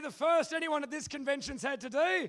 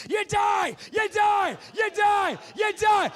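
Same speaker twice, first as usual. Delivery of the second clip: loud high shouted chant; screaming you die; 7 semitones higher, 9 dB louder, slower than usual. Acted. Host leads the crowd killing off the players, screaming. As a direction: yell each one harder.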